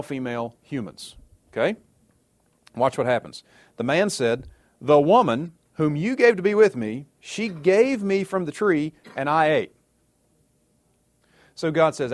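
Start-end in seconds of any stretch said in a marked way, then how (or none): 4.8-9.7 blaming. Acted. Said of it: "Adam turns the blame".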